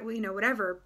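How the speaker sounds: normal talking voice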